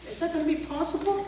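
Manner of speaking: normal talking voice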